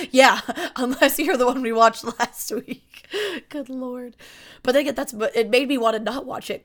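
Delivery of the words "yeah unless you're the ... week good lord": laughing. Real, not acted. Laughing at shared memory.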